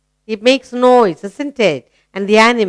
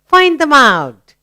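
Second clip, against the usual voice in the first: higher-pitched mock alarm, lightly acted; mama raising the alarm; 7 semitones higher than usual. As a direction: play mama spotting a mouse. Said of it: "immediately mama will say".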